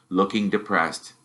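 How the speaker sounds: ordinary delivery, nothing marked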